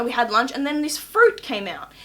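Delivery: normal talking voice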